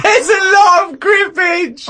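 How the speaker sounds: very high, drawn-out incredulous squeal; mocking laughing shriek. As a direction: squeal it slowly through laughter, mimicking. Mocking the made-up word in hysterics.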